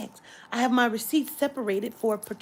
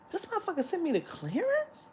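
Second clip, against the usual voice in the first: outraged disbelief; 6 semitones higher, 7 dB quieter than usual. Retold or imitated. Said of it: "now I'm back there like".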